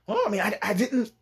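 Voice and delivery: high, halting backpedal; acting out a nervous stammer; flustered stutter. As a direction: stumble over the words, freaking out. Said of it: "He's starting to stutter, just freaking out".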